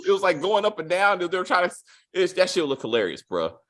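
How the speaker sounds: usual speaking voice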